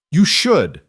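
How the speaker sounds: loud sharp shout of protest. A narrator's reading. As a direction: cry it out, losing composure. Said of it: "She was shouting now".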